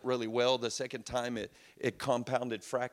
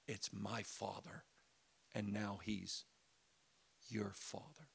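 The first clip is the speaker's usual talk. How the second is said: softer and softer into a whisper; whispered reveal; 5 semitones lower, 10 dB quieter than usual. Acted. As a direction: fade to an intimate whisper. Whispered revelation as Jesus.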